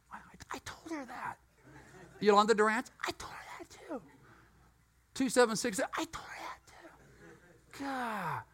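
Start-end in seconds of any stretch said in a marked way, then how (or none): 0.1-1.4 under-the-breath whisper; exasperated whispered aside. Real, not acted. Muttered aside about phone rep. 3.1-4.0 under-the-breath whisper; exasperated whispered aside. Real, not acted. Muttered aside about phone rep. 5.8-8.4 slow, drawn-out whisper; weary slow whispered aside. Real, not acted. Muttered aside about phone rep.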